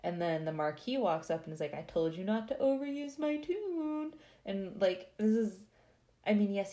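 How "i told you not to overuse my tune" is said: high-pitched, teasing I-told-you-so; mock chiding. Marquis rubbing it in to the mobbed man, mock chiding. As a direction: scold in a put-on high voice.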